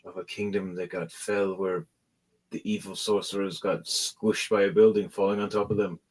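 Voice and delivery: subdued leader. Acted. Plays assassin leader marvelling.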